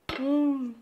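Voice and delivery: drawn-out "mm" of pleasure; savoring hum. Real, not acted. Tasting the meatballs for the viewers, savoring hum. First taste of the dish.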